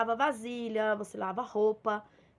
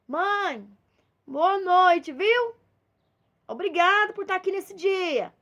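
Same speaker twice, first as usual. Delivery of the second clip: higher and louder, cheerful throwaway thanks; breezy goodnight; 6 semitones higher, 7 dB louder, slower than usual. Retold or imitated. Child going to bed tells mother on sofa goodnight, casually cheerful. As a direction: toss off a goodnight without stopping.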